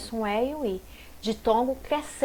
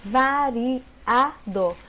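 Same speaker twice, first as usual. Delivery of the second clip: very slow variado, every syllable set apart; much slower than usual. Shown as a demonstration. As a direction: break variado into va-ri-a-do, pausing after each. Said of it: "Separação sila-, silábica".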